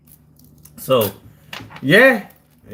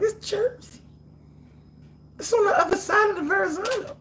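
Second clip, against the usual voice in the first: very high, squeaky aside; high, strained comic protest; high joking squeal. Real, not acted. Joking that Staten Island is Jersey.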